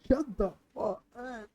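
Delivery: hammed-up affronted put-on voice; theatrical fake offence; mock offended voice. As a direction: play a huffy diva. Put-on voice scolding chat.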